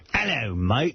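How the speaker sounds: lightly put-on Australian accent; a hint of a thick Australian "mate". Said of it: "shocked by the thick Australian accent".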